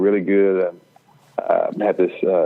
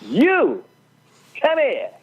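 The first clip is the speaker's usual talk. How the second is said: high, called-out preacher command; much slower than usual. Retold or imitated. Revivalist calling him out of the crowd, high commanding call. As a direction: summon him like the revivalist picking him out. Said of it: "he points to me and says".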